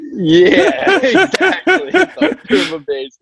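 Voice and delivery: laughing it out, high and bright; words bubbling through laughter. Real, not acted. Musician laughs as host guesses the story, laughing.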